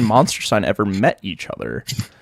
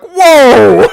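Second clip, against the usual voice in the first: mock surprise; 12 semitones higher, 10 dB louder than usual. Party reacts in surprise.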